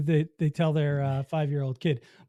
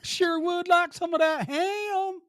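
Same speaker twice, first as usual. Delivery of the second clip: put-on silly ham girl voice; 11 semitones higher, slower than usual.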